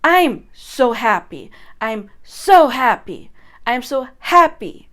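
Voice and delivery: slow, loud, emphatic declarations; powerful incantation. Shown as a demonstration. Coach playing with a loud incantation. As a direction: hammer each line with power. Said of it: "you can play with it".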